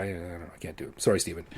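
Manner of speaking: ordinary talk, nothing marked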